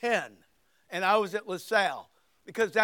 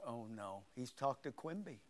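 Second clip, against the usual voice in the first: much softer, lower, sinking dread; dread under breath; 8 semitones lower, 16 dB quieter than usual. Retold or imitated. Boy realizes grandpa already knows, soft dread. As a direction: think it to yourself in panic. Inner panic thought.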